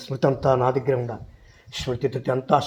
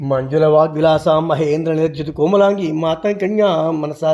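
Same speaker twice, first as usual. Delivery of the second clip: loud, fervent Sanskrit chanting; full-voiced stotram recitation; devotional hymn recitation; 5 dB louder than usual. Real, not acted. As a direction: chant the hymn like an offering at the temple.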